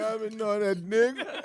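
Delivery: high, loud mock quote of the co-host; imitating her, loud and cracking up; amused mimicry. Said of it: "She said".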